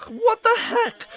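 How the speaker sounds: very high incredulous squeak; squeaky mock disbelief. Retelling a reaction to an insult, mock incredulous. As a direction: leap far up in pitch, acting stunned.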